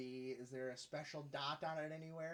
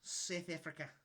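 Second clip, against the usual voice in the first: put-on South African accent; 4 semitones higher than usual. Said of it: "in a South African accent".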